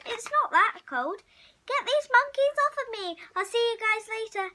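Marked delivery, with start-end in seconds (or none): none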